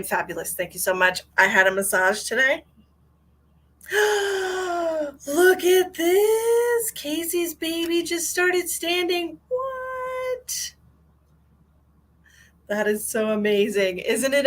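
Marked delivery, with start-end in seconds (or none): 3.9-10.7 gasp, then high, drawn-out squeal of amazement; thrilled, slow high-pitched astonishment; amazed delight. Real, not acted. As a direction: marvel in a very high, stretched voice. Amazed by news of baby standing.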